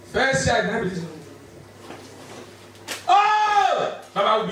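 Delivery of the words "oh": sudden high cry of surprise; surprised shout. Real, not acted. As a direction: blurt out a shocked oh. Sudden exclamation.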